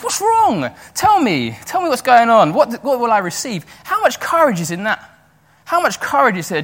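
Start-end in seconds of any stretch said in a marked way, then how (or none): 0.0-3.6 high-pitched, eager retold questions; animated, higher voice pressing for answers; urgent questioning. Acting out David questioning terrified soldiers.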